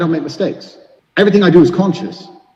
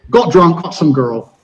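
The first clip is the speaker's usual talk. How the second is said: ordinary talk, nothing marked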